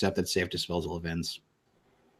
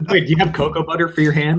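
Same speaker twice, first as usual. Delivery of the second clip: high, loud laughing question; teasing through laughter; 9 semitones higher, 8 dB louder than usual. Real, not acted.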